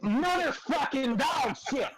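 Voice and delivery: furious outburst. Real, not acted. Player raging at a bad roll, furious. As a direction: curse the dice at full fury. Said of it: "calm down, [name]".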